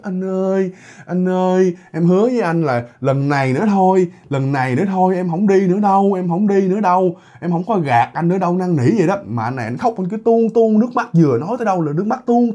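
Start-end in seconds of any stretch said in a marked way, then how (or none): 0.0-8.6 higher-pitched tearful begging, acted; sweet, mournful pleading. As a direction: plead like a sorrowful wife promising to stop. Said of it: "cái giọng ngọt ngào mà thê lương mà thương, khóc rồi hít". (giọng năn nỉ ngọt ngào, thê lương)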